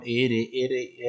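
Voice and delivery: R, R as trilled rr; rolled r sounds. Shown as a demonstration. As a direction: trill each R. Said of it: "o no puedes pronunciar". (R, R vibrando fuerte)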